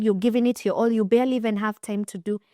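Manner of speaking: ordinary delivery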